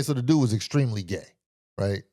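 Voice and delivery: ordinary delivery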